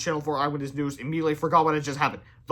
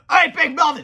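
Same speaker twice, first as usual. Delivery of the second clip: loud, high breathless call; out-of-breath shout; 10 semitones higher, 9 dB louder than usual. A narrator's reading. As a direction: call out huffing and puffing. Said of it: "shouted George, huffing and puffing".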